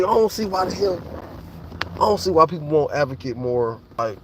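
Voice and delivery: normal talking voice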